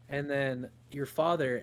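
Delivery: ordinary talk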